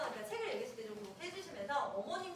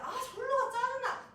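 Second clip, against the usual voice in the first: high, louder whiny brush-off; acted irritation; 10 semitones higher, 6 dB louder than usual. Scolded child rebuffing a friend, acted annoyance. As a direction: imitate an annoyed kid shooing a friend away.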